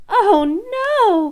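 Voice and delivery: high, loud cry of dismay. Acted. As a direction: cry out like a princess who lost her ball. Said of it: "cried the princess".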